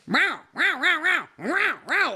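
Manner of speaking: high loud proud meows; cat showing off to owner, high meows; cat meowing. Retold or imitated. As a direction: meow like a boastful cat. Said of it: "and goes".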